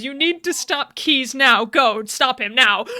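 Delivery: loud, slow, punched retold panic; panicked urging. Cortana ordering Master Chief to stop Keyes, panicked urging. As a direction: urge it forcefully, word by word. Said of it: "and proceeds to panic".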